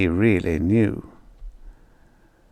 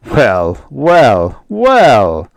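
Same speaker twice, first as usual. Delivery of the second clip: pleased father; 2 semitones higher, 6 dB louder, much slower than usual. Acted.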